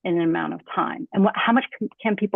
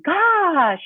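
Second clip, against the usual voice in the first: much higher, louder "Gosh" falling; exclaimed; 10 semitones higher, 4 dB louder than usual. Shown as a demonstration. Teacher demos lengthening with a surprised exclamation.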